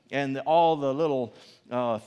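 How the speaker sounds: usual speaking voice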